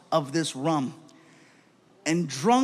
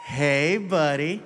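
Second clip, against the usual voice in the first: put-on talking to a toddler; much slower than usual. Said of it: "talks to me like I'm two".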